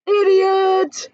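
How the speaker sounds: high, loud insult; yelling. A narrator's reading. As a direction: hurl it across the sky. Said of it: "he yelled".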